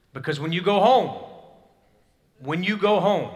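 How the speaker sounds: loud, high repeated warning; booming repeated warning. Real, not acted. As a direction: hammer the phrase home. Preacher building warning.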